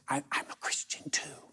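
unvoiced whisper, hesitant; whispered confession. Timid nurse confessing her faith to the pastor, whispered. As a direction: confide it in a secret whisper. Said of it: "Why are we whispering?".

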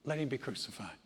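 the crowd's verdict told softly and sadly; hushed crowd cry. A narrator's reading. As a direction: let the cruel words fall quietly. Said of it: "and said".

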